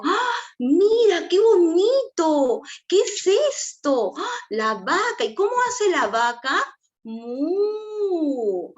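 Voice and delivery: very high, slow, drawn-out wonder; emotional child-directed excitement. Shown as a demonstration. Adult showing a cow to a small child, exaggerated excitement. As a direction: gasp and marvel in a big singsong voice. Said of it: "necesito generar mucho el tema de la emoción".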